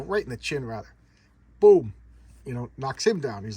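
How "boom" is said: loud mouthed boom for a punch; big punchy boom sound effect. Retold or imitated. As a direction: slam the word out like a comic-book hit. Punch sound in fight retelling.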